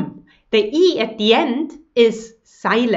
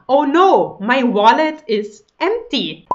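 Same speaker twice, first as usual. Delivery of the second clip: normal talking voice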